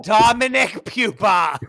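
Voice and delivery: high, loud, slow drawn-out outrage; shocked scolding. Real, not acted. Cohost scolding his friend's joke, loud and shocked. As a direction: stretch the name out in scandalized disbelief.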